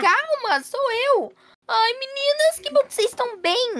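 high-pitched relieved mother; relieved reunion. Acted. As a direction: soothe and greet them in a high, eager voice. Mãe acalma e reencontra filhas. (aliviada, voz bem aguda)

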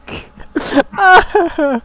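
loud, high burst of laughter; real laughing fit. Real, not acted. Cracking up at a friend's joke, real laughing fit. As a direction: crack up loudly, pitched high.

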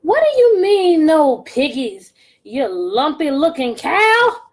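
high, loud witch scream. Acted. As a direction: scream it at the cow. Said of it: "screamed Gritch".

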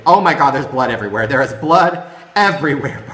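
loud, horrified exclamation; alarmed outcry. Real, not acted. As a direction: cry out in shock at the screen. Reacts to gory game clip.

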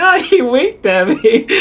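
high, slow delighted retelling through laughter; laughing hard. Retold or imitated. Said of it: "I laughed so hard".

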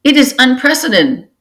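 high, shocked cry; cried out. A narrator's reading. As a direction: protest in alarm. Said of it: "cried a tortoise queen".

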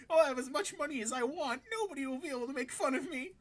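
high, excited character voice; gleeful boasting. Acted. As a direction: boast like a kid carried away by a daydream. Acts boy boasting of conquest.

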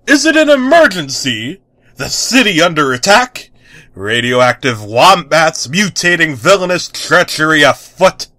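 high, loud excited superhero voice; thrilled at the thought of danger; eager hero. Acted.

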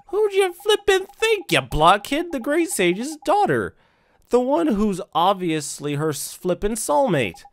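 very high, loud, drawn-out scolding; exasperated excitable sidekick. Acted. Drippy telling the blockhead who she is, exasperated. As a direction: stretch the words out in high-pitched disbelief.

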